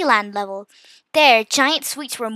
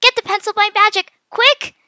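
high, loud, hurried order; urgent shout; 9 semitones higher, 6 dB louder than usual. A narrator's reading. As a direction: shout for help before it's too late. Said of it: "Mia shouted".

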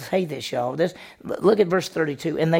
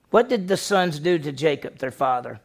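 ordinary delivery

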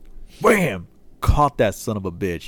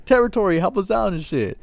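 much higher, indignant mock rant; annoyed rant; 12 semitones higher, 3 dB louder than usual. Fuming at the security guard who ignored them, high indignant rant. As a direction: vent at her like she is useless.

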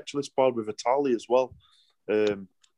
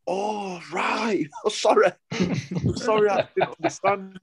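sheepish surprise; 10 semitones higher than usual. Retold or imitated. Embarrassed realisation, laughing apology.

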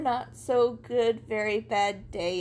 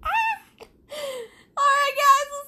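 high, loud laughing goodbye; excited sign-off; 12 semitones higher, 7 dB louder, much slower than usual. Real, not acted. Signing off the podcast to listeners, loud and laughing. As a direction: sing out the farewell, high and loud.

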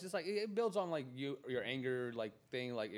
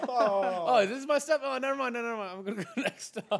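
high squeaky mock panic; hammed-up nervous squeak, laughing; mock flustered pretend; 11 semitones higher, 9 dB louder than usual. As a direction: play a flustered rider pretending to dodge. Mocking the friend's pretend dodge.

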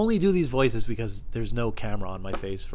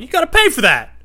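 high, loud outraged yell; hammed-up angry shout; screamed demand; 13 dB louder than usual. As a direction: holler after a shoplifter, punching the last word. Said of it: "I'm screaming that like that".

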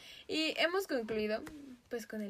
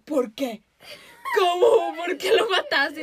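loud, much higher, laughing through the yell; mock shocked shout; 7 semitones higher, 14 dB louder, slower than usual. Mom discovering the dead duck, mock shout. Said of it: "mi mamá dijo".

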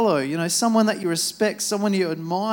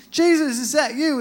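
higher and louder, calling across the water; 8 semitones higher, 5 dB louder than usual. Retold or imitated. Peter calls out to Jesus across the waves, higher and louder. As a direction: call out over the storm to him.

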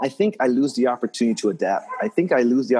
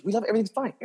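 mock client, higher and softer; gushing client; 12 semitones higher, 4 dB quieter, much faster than usual. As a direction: play the delighted client, up high and soft. Client voiced in retold story.

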